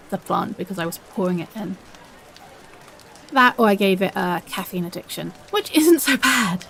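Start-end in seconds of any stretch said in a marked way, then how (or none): none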